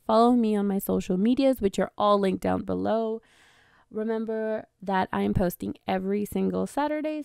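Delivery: cheery sign-off pitch to viewers; upbeat outro sign-off. Acted. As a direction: wrap up the video like a host, cheerful and loud.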